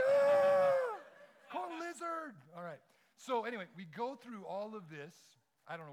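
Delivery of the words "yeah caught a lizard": much higher, much louder triumphant whoop; cheering crowd. Retold or imitated. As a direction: yell it like a crowd cheering a win. Onlookers cheer the lizard catch.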